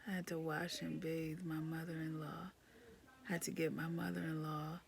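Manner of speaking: hushed, worn-out listing; tired whisper. Real, not acted. Worn-out mom listing chores in a whisper. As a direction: whisper it like you're spent.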